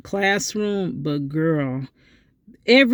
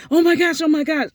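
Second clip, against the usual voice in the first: retold panic; 10 semitones higher, faster than usual. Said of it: "they're like".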